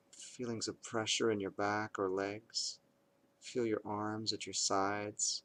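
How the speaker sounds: calm, measured meditation voice with long pauses; guided meditation. Acted. Parent leading kids through bedtime meditation, calm and measured. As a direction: guide them unhurriedly into awareness of the breath, leaving space between phrases.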